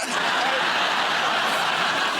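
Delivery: breathy helpless laugh; burst of laughter. Real, not acted. Guest laughing at own monkey story, helpless laugh. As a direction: crack up at your own joke.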